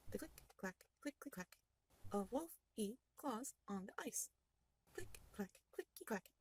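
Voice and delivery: soft, slow, hushed claws clicking on ice; hushed sound effect. A narrator's reading. Game master hushes the table with wolf claws on ice, softly. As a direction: tiptoe the words like creeping claws.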